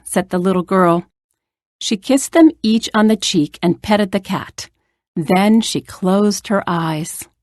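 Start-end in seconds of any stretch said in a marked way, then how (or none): none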